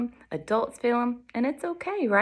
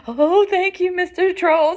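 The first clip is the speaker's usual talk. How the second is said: high, louder goat voice; grateful little goat; 10 semitones higher, 7 dB louder than usual. Acted. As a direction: gush thanks in a high-pitched voice. Relieved goat thanks the troll.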